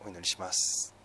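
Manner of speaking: soft, low praying voice with long pauses; solemn prayer. Real, not acted. Pastor praying to the Father in repentance, hushed prayer. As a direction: pray quietly, pausing between short phrases.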